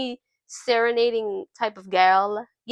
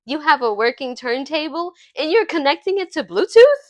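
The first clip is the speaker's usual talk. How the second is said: higher-pitched playful indignation; mock outrage; 5 semitones higher, 4 dB louder than usual. [name] teasing her for using Bluetooth, mock outrage. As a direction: act scandalized, rising into disbelief at the end.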